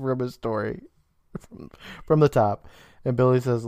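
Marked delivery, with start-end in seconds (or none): none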